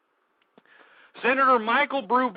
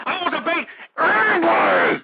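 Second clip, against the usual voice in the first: real high-pitched shout; screaming challenge; 6 semitones higher than usual. Real, not acted. Radio host challenging anyone to debate the family, screaming. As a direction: build to a yell on the last word. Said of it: "Why are you screaming?".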